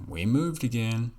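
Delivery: high, loud abrupt announcement; parents announcing. Retold or imitated. Parent picking the kid up, announcing the move, loudly. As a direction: call it out like a parent springing news.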